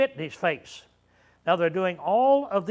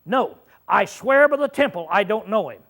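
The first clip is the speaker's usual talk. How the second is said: swearing an oath; 3 semitones higher, 5 dB louder than usual. Shown as a demonstration. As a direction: swear it like your life depends on it. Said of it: "what that means is".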